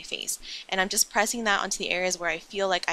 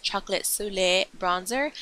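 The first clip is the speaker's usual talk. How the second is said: French accent. Shown as a demonstration. Beauty vlogger naming her bronzer to viewers, in a playful French accent. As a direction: say it the French way, just for fun. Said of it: "I do say it in a French accent".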